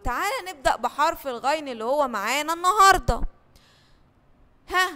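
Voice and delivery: ordinary talk, nothing marked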